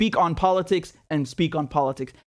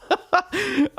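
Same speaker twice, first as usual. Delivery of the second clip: high burst of laughter; 10 semitones higher than usual. Real, not acted. Laughing at his own impression.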